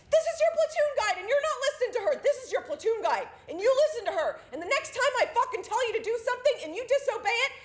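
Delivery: loud, high drill sergeant tirade; screaming at them. Retold or imitated. Drill sergeant berates platoon for ignoring their guide, screaming. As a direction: chew out the platoon at full volume. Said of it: "he starts screaming at them".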